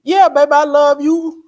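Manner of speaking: high, loud teasing lover's voice; mock sweet talk. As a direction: sweet-talk her while the hand says otherwise. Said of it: "I'd be saying".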